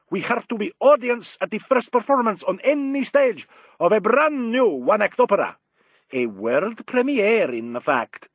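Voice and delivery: put-on high, loud, declamatory Italian accent; marked Italian accent. A narrator's reading. Italian maestro announcing an opera premiere, marked Italian accent. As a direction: proclaim it in a theatrical Italian accent. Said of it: "spoke with a marked Italian accent".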